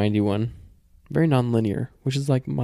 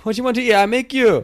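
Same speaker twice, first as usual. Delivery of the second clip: very high, hammed-up Japanese granny; mimicked Japanese-accented grandmother, pitched way up; imitated Japanese grandma accent; 13 semitones higher, 4 dB louder than usual. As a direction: fuss loudly in a high mock Japanese accent. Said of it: "do your Japanese woman accent".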